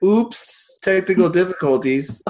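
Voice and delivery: high, put-on oops; mock apology. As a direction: feign an accidental dropout. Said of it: "drop out and be like".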